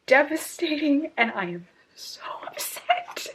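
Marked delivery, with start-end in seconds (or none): 1.2-3.3 a softer, whispered admission. Real, not acted. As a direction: breathe it out quietly. Devastated by scene.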